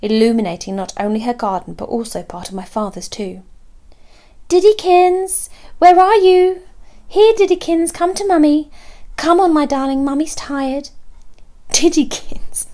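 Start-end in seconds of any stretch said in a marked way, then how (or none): 4.5-12.7 high, loud sing-out to a cat; cooing pet call. A narrator's reading. Neighbour calling her cat from back door, cooing.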